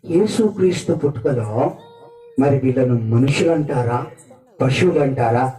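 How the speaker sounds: ordinary delivery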